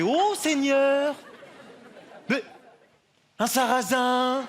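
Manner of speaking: clutch your pearls and drag it out; shocked church lady. Retold or imitated. Church lady spotting the latecomer, in high drawn-out alarm. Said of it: "elle a fait".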